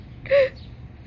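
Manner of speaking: high unvoiced fake sob gasp; hammed-up tearful gasp; mock sobbing gasp. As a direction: gasp like choking back tears. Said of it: "I'm gonna cry".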